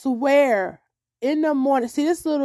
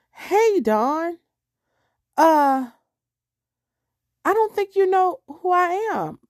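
lightly put-on higher neighbour voice; imitated caller; 5 semitones higher, slower than usual. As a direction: imitate a hesitant caller, pitched up. Imitates neighbour calling on the phone.

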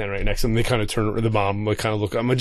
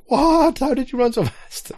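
very high-pitched, incredulous squeal; mock astonishment; 12 semitones higher than usual. Dad watching his daughter sprint away, mock astonishment. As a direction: shoot the voice way up in retold disbelief.

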